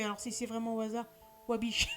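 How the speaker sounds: normal talking voice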